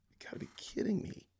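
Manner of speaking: quick, high breathy whisper; whispered disbelief. Real, not acted. Streamer about to play a dreaded clip, whispered disbelief. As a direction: whisper it fast in exasperation.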